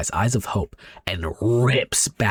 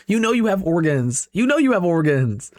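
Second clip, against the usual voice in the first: ordinary delivery, nothing marked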